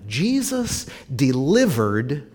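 usual speaking voice

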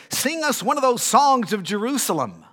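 loud, high taunting order from a tormentor; insistent demand. Acted. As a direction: demand it at full voice, pitched well up. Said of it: "Our tormentors insisted on a joyful hymn".

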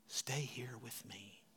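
slow, soft whispered comfort; tender whisper. Acted. God gently comforting Elijah, slow and whispered. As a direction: hush it like a comforting friend. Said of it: "The Lord said to him".

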